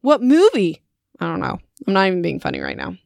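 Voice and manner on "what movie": sarcastic challenge. Pretend. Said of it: "I'd be like".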